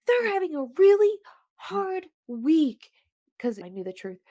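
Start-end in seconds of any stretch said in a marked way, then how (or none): 0.1-2.9 high, loud indignant defence; protective. Retold or imitated. Podcaster imagining defending the shopper, high protective outburst. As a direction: jump to their defence, pitched way up. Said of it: "The protective part of me".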